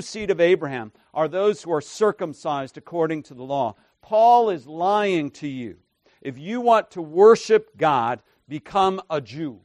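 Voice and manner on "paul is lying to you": ordinary delivery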